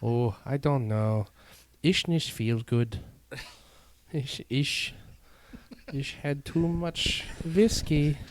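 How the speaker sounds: a slow, drowsy mock German accent. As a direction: drag it out like a tipsy German. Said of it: "You sound like German Little Nicky".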